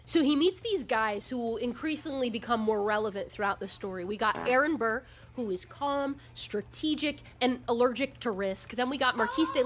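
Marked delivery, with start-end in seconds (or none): none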